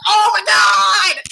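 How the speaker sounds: reliving total shock at the twist; mind-blown outburst. Retold or imitated. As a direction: cry out as if floored. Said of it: "I was like".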